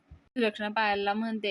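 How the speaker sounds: plain reading voice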